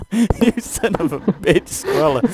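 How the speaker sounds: high, loud, laughing curse; exasperated yell. Real, not acted. Player cursing the game's writer, in a high loud laughing yell. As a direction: blurt it out half laughing.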